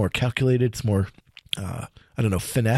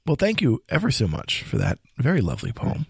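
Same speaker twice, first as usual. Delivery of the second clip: usual speaking voice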